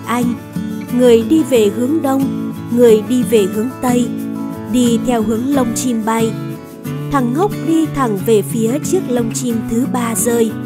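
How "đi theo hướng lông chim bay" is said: usual speaking voice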